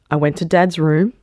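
usual speaking voice

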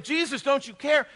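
high, loud panicked plea; hammed-up alarm; freaking out. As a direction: imitate frantic friends waking a sleeper. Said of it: "they're freaking out".

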